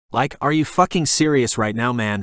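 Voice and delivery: usual speaking voice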